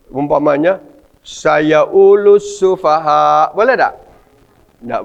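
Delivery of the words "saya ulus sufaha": slow Egyptian-style reading with the qaf dropped. Shown as a demonstration. Teacher shows how Egyptians would read the Quran. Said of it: "orang Mesir kan baca begini".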